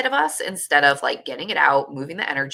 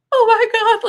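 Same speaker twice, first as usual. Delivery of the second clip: much higher and louder, choked up with tears; teary oh my God; 7 dB louder than usual. Retold or imitated. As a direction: gasp it out as tears well up. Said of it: "welling up with tears".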